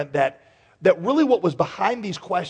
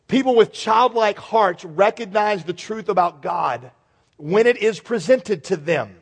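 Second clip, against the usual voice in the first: preaching; 4 semitones higher, 3 dB louder, slower than usual. Acted. Preaching at full force.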